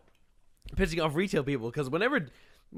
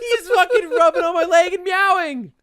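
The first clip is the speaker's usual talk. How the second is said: exasperated complaint; 11 semitones higher, 5 dB louder than usual. Retold or imitated. Exasperated retelling.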